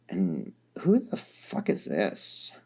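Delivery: low and dismissive, slow; tired Dirk, low and slow. A narrator's reading. Dirk, looking at a stranger, low, slow and dismissive.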